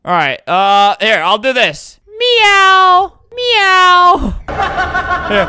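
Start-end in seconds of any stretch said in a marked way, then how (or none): 2.1-5.5 high, loud, drawn-out meows; pretend cat. Player being [name]'s cat, high mock meowing. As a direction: meow like a put-on cat. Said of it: "I'll be her cat".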